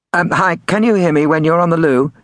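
high-pitched prank-call voice; prank caller. A narrator's reading. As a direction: ask innocently in a high put-on voice. Prank caller rings his next victim.

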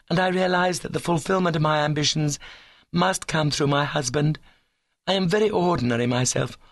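put-on high, earnest young lady's voice; lofty declaration, held up high in pitch; ambitious young woman. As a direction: play a high-minded girl explaining herself.

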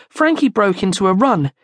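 ordinary delivery

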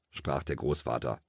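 plain reading voice

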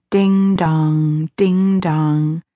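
sing-song imitation of a clock chime; drawn-out, chiming ding dong; alarm clock chime. A narrator's reading. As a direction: ring the words out evenly, like a bell. Narrator voices alarm clock chime.

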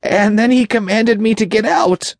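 very high, frightened demon voice; high-pitched, fearful underling; breathless fear. A narrator's reading. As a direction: blurt it out as a terrified creature. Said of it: "his voice breathless with fear".

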